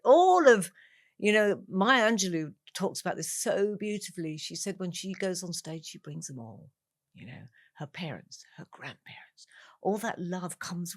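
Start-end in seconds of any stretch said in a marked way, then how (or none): none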